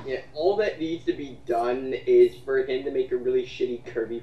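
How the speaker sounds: ordinary talk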